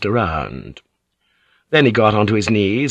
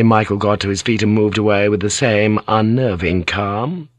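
usual speaking voice

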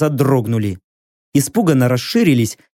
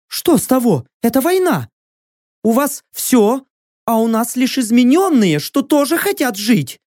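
high, firm warrior woman's voice; a proud captive bargaining; proud female warrior; 9 semitones higher than usual. Acted.

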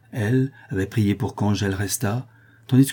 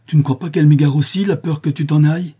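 high, loud bitter reproach; rancorous, accusing question; resentful tone; 8 semitones higher, 8 dB louder than usual. A narrator's reading. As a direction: throw the grudge back at her. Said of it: "toujours sur un ton de rancune".

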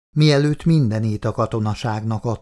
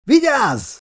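much higher, louder cry; shouted warning; 13 semitones higher, 4 dB louder than usual. A narrator's reading. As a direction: scream the warning. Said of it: "kiáltotta Alisa".

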